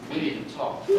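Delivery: hushed, ominous whisper of a parent. Retold or imitated. Parent announcing a serious talk, hushed and ominous. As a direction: breathe it like a warning. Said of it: "when one of your parents says".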